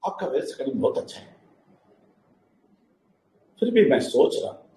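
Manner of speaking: a hint of a timid excuse-maker; mocking hesitant student. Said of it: "बहुत सारे लोग ऐसा बोलते हैं".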